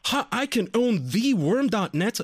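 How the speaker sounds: high, drawn-out retold glee; gleeful discovery. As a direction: relish the prize slowly, voice high. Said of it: "And I was like".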